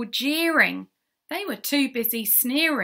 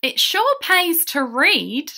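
loud, raised character line; hearty concession; 7 semitones higher, 8 dB louder than usual. Acted. Rescued llamas conceding to their bookish friend, hearty. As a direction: declare it high and loud. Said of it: "they all had to concede".

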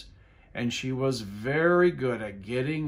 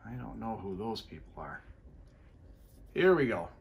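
ordinary delivery, nothing marked

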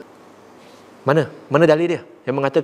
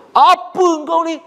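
much higher, louder, slow snap; harsh rebuke; 13 semitones higher, 5 dB louder than usual. Shown as a demonstration. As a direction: bark at someone rudely. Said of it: "Berbeza dengan".

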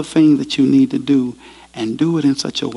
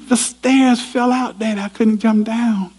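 put-on scared boy; 8 semitones higher than usual. Boy tells his father the stairs fell, high and scared. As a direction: plead like a small trapped boy.

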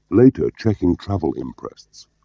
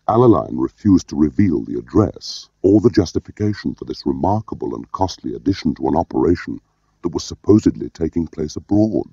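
usual speaking voice

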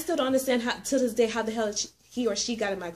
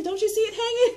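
high panicked laughing squeal; 12 semitones higher, 4 dB louder than usual. Retold or imitated. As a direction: squeal it, half laughing in fright. Panicking, spider still in car.

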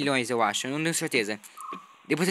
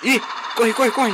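high, loud panicky run; being chased; 8 semitones higher, 6 dB louder, slower than usual. Real, not acted. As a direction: urge yourself to flee. Brachiosaurs attack him.

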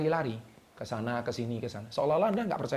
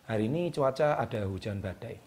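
monotone forecast, low and uneventful; flat intonation; 3 semitones lower, 4 dB quieter than usual. Shown as a demonstration. Coach showing viewers a dull forecast, flat intonation. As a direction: read the storm as if nothing is happening. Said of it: "Tapi kalau Anda nadanya datar".

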